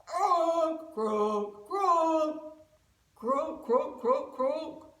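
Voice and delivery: loud, bouncing frog croaks. Shown as a demonstration. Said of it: "Give me your best croak".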